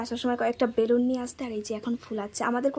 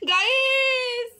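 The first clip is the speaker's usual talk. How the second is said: excited; 9 semitones higher, 5 dB louder, much slower than usual. Real, not acted. Cake reveal, drawn-out call.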